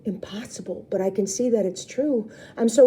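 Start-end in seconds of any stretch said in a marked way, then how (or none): none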